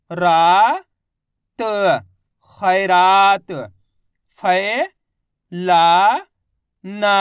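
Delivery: slow, loud, each syllable set apart and then blended. Shown as a demonstration. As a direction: spell it out like a teacher to children. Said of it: "देखो किस तरीके से". (धीरे-धीरे, ज़ोर से, अक्षर-अक्षर अलग बोलकर)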